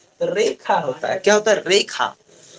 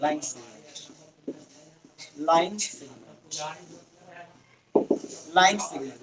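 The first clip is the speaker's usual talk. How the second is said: softer, slow, drawn-out reply; soft drawn-out answer; 6 semitones lower, 10 dB quieter, much slower than usual. Shown as a demonstration. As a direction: answer for the class, softly and slowly. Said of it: "किसकी बारी?".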